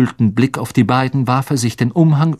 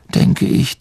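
ordinary delivery, nothing marked

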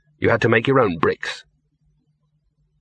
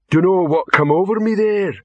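troll voice; 7 semitones higher, 3 dB louder than usual. Acted. Troll puzzled after spell.

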